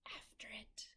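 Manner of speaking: hushed, soft whisper. A narrator's reading. Crew whispers back to the captain. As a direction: breathe it secretly. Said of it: "we all whispered".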